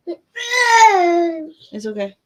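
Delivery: very slow, high, drawn-out comfort. Real, not acted. Mother soothing her fussing baby, very slow and high. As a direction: coo it slowly to calm him.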